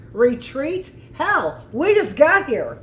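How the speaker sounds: high, loud gung-ho captain's comeback; defiant retort. Acted. Marine captain refusing to retreat, defiant and loud. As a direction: throw it back at them like a bold Marine.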